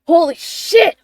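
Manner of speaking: loud, high shocked cursing; gasping panic. Acted. Revived Leto wakes gasping, crying out in panic. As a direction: cry out as if jolted back to life. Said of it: "Leto gasps for air".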